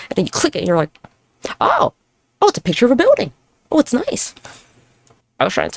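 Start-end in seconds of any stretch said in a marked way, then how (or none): 1.6-4.3 high-pitched mock wonder; a sudden high "oh" of discovery; acted realization. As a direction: play the puzzle finally clicking, pitched up. Acted reaction to puzzle clicking.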